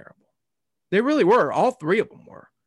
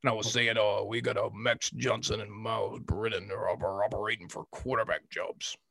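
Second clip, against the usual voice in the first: low, soft put-on football coach voice; Coach Boyd impression; 4 semitones lower, 6 dB quieter than usual. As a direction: speak like a coach at the podium, lower and quieter. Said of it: "what I said in the Coach Boyd voice".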